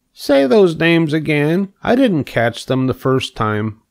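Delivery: high pig voice, puzzled and curious; eager pig. A narrator's reading. Pig asks spider to repeat the names, eager pig voice. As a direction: play the pig begging a repeat.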